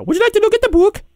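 very high, quick squeaky voice; interrupting book pusher. Acted. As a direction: rattle off the offer in a squeaky pitch. Repeated interrupting catchphrase.

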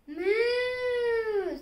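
high, drawn-out cow moo; child playing a mooing cow. A narrator's reading. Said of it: "said Cow".

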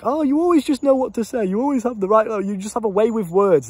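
put-on admiring girl. Girls telling the coach he has a way with words, mock gushing. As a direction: gush like an impressed date, half mocking. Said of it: "where they're like".

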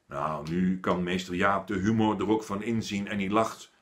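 plain reading voice

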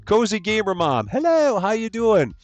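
high, sing-song hellos to each viewer; cheery stream greeting. Real, not acted. Streamer welcoming chat members by name, high and sing-song.

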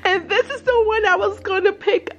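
high, tearful excitement; real high, emotional delight; overcome with gratitude. Real, not acted. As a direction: gush thanks, close to happy tears. Thanks sender, overcome by the gift.

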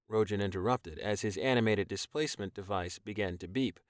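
ordinary delivery, nothing marked

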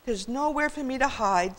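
high, self-pitying whine; whining. A narrator's reading. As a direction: whine it like a helpless child. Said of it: "Sam whined".

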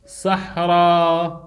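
صحراوات slow and low, in long held parts; drawn-out demonstration. Shown as a demonstration. Teacher builds the feminine plural for students, slow drawn-out demonstration. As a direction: stretch صحراوات out low, part by part.

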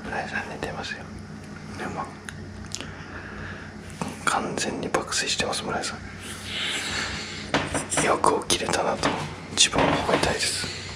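whispering. Real, not acted. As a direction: keep it down next to the sleeper. Whispering beside sleeping Murai.

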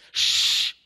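whispered shush; a sharp whispered shh; hushing shh. Acted. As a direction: hush him quickly. Angel hushes Muhammad.

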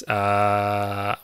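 ordinary talk, nothing marked

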